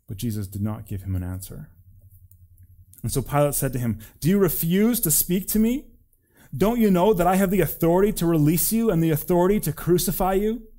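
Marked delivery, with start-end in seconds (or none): 4.2-10.7 high, loud incredulous questioning; raised voice, climbing at the end; indignant demand. A narrator's reading. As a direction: press a silent prisoner for an answer. Pilate presses the silent prisoner.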